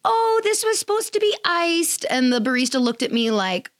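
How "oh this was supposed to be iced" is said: high, meek little voice, apologetic; timid complaint. Retold or imitated. To the barista about her latte, high meek voice. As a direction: squeak it out like you hate to bother anyone. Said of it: "And so I kind of was like".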